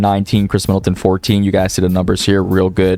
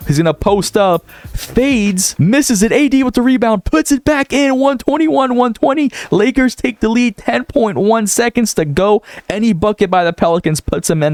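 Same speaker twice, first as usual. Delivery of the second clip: rising to a frantic call for the go-ahead bucket; excited play-by-play; 11 semitones higher than usual. Acted. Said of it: "Can I just say W commentary".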